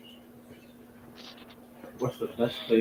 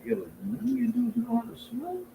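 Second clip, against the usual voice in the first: incredulous; 9 semitones higher, 4 dB quieter than usual. Retold or imitated. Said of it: "I was like".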